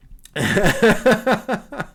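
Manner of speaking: real amused laughing fit. Real, not acted. Streamer reacting to chat's Belfast joke, laughing. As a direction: burst out laughing, then tail off.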